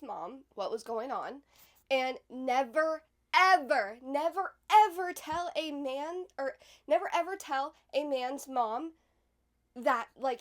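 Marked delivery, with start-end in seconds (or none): 1.9-8.9 never and ever punched hard and loud, drawn out slow; never ever stressed. Real, not acted. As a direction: hammer each never, ever as a warning. Emphatic warning to the audience.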